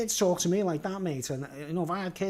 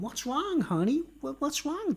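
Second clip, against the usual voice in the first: higher, mock-concerned parent voice; worried parent; 9 semitones higher, slower than usual. Parent to disappointed kid, mock-worried and higher. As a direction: coax the child like a fretting mum. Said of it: "And they're going".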